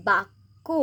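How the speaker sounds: বাক্য with the ক doubled, said as bakko; doubled consonant. Shown as a demonstration. Teacher showing pupils how to say বাক্য: doubled k. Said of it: "টটাকে আমরা দুবার উচ্চারণ করব".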